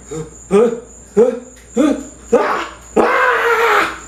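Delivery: startled huhs building to high screams; flipping out. Retold or imitated. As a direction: imitate panic mounting into a shriek. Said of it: "I start flipping the fuck out".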